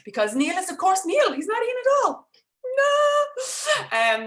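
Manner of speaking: embarrassed laugh-cry, pitch climbing; mortified. Real, not acted. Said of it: "I'm mortified".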